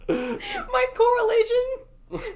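high, slow, laughing almost to tears; laughing hard. Real, not acted. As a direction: squeeze the words out through laughter.

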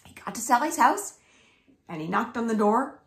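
ordinary delivery, nothing marked

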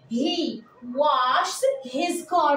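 very slow, word by word; slow dictation. Shown as a demonstration. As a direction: dictate it slowly for them to copy. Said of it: "dictating example sentence".

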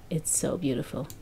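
moved murmur after hearing the song; soft murmur. Real, not acted. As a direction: let the praise fade almost to a breath. Repeating praise, then softening.

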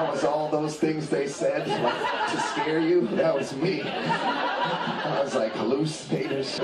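high-pitched, breaking up with laughter; laughing while talking. Real, not acted. Comic confessing a bad weed trip to the audience, laughing. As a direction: tell it through helpless real laughter. Cracks up telling weed story.

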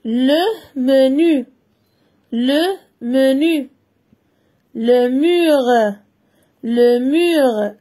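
"le menu", "les murs" read slowly and distinctly; teacher reading words for children; slow careful reading. Shown as a demonstration. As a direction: read each word slowly and clearly. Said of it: "Je lis".

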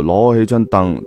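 plain reading voice

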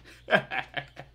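amused chuckle; short laugh. Real, not acted. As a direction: laugh at own joke.

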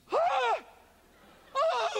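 high loud gasping yelp; shocked yelp. Retold or imitated. Feet enter the icy sea, sharp high yelp. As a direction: yelp at the cold water. Feet hit freezing water.

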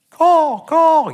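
high loud bird-like caw; cawing cry. Shown as a demonstration. Preacher showing the Greek cry out, a loud caw. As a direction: caw it out like a crow. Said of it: "It's supposed to sound like a, like a cawing".

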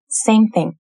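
ordinary talk, nothing marked

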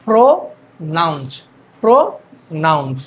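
প্রোনাউন্স slowly split, first part rising, second falling; syllable-by-syllable pronunciation. Shown as a demonstration. Teacher models the word for beginners, প্রোনাউন্স in slow syllables. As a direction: pause between the two halves of প্রোনাউন্স. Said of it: "এটা হচ্ছে".

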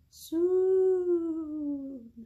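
high, long-held word like wind blowing; drawn-out promise. Acted. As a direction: stretch it out like the wind.

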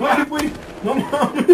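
high, slow words through laughter; laughing. Real, not acted. Gamer laughing after rivals cut him off.

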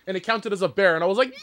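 ordinary talk

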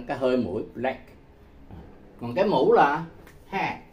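normal talking voice

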